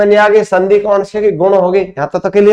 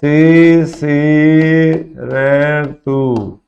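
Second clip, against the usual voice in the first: Shishir stretched out very slowly; slow dictation; much slower than usual. Shown as a demonstration. Teacher dictating a season name to the class, very slow. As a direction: drag Shishir out so students can write it. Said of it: "एक आ जाता दोस्तों".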